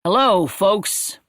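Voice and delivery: slow, drawn-out friendly American greeting; a soldier's easy American hello, stretched out; American accent. Acted. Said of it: "in a perfectly distinct American accent".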